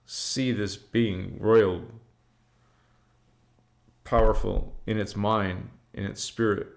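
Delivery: slow, hushed trance voice; trance channeling. Acted. A channeler speaking words from a trance, hushed and slow. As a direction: murmur the names as if receiving them.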